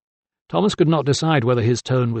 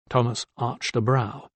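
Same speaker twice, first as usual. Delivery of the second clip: ordinary delivery, nothing marked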